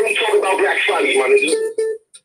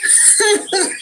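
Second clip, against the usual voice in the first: high, gleeful laugh; delighted laugh; 10 semitones higher than usual. Real, not acted. Caller loving the Make My Day law, laughing.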